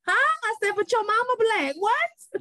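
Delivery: high-pitched incredulous mom reaction; baffled disbelief. Retold or imitated. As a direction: reenact a thrown, can't-believe-it comeback. Mother acts out her startled reply.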